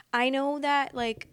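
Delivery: ordinary talk, nothing marked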